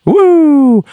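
high, loud drawn-out woo; Ric Flair woo. Pretend. Imitating Ric Flair's trademark woo, loud and high. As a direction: belt out a signature wrestler's woo. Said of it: "comes back and gets in the ring and says".

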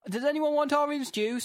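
high-pitched calling out, no real volume; mock mum's shout. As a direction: imitate a mum calling the children from outside. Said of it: "comes outside and shouts".

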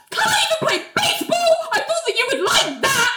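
very high, squeaky mock wail; hammed-up squeal; squealed outburst. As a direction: blurt it out way up high, half laughing. Acting out Ali's sudden outburst.

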